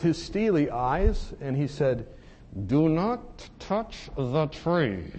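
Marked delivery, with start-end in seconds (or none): 2.7-5.1 very slow, stern warning with each word weighed; holy indignation. Retold or imitated. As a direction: lay down the law slowly and firmly. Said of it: "This was righteous, holy indignation".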